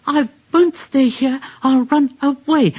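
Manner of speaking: high anxious engine voice; high fretful puffing rhythm; frightened Percy. A narrator's reading. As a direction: chant it like a scared runaway engine.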